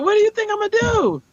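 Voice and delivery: high, fast incredulous reply. Retold or imitated. She answers the frantic neighbour in high, fast disbelief. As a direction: throw the question back in disbelief.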